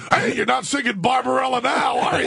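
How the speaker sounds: high, laughing taunt; teasing shout. Retold or imitated. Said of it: "And he goes".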